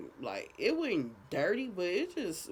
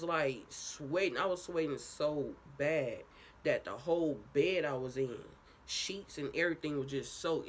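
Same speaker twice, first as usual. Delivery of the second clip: ordinary talk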